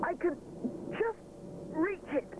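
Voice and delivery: high, pleased little cry; a small win, said proudly; triumphant. A narrator's reading. Rupert reaching the slit, lightly triumphant. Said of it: "Rupert cries triumphantly".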